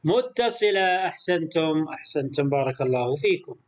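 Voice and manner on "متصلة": متصلة stretched slowly and clearly; teacher answering متصلة slowly; slow model answer. Shown as a demonstration. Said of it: "متصلة أم منفصلة".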